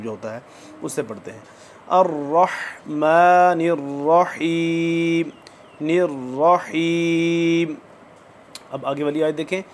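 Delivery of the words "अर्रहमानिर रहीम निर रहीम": अर्रहमानिर with the हा from the throat, slow; slow recitation. Shown as a demonstration. Teacher shows students the throat हा, slowly. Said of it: "हलक के आखिरी हिस्से से".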